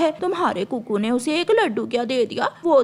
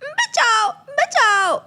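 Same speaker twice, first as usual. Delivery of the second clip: high panicked cries for help; screaming for help; 8 semitones higher, much slower than usual. Acted. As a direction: shriek for help as the wing burns. Crow's wing catches fire.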